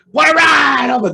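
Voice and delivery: high, loud announcer cry; excited commentator shout. Retold or imitated. Quoting an announcer's call, high and loud. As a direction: belt it out like ringside.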